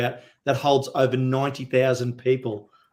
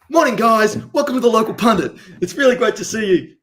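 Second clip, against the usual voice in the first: bright, fast, louder and higher upbeat welcome; chirpy morning host; 9 semitones higher, 7 dB louder than usual. Retold or imitated. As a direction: open the show with big cheery energy. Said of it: "normally it's like".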